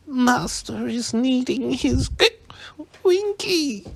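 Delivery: high, pleading elf whimper, drawn out; a tipsy house elf whining for her master. A narrator's reading. Drunk house elf whimpering for her absent master, pleading. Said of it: "whimpered the elf".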